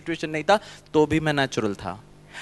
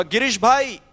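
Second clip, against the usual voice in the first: higher, louder voice of a man from the audience; man in the audience teasing the speaker, in a higher, louder voice; 7 semitones higher, 3 dB louder than usual. Retold or imitated. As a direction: play the heckler telling the speaker to update his prayer. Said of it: "सभा में से एक भाई साहब ने कहा".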